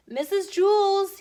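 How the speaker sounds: high, slow, loud call across the room; a kid calling the teacher from the doorway, loud and high. Acted. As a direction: call her name out, drawn out and high. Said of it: "he called".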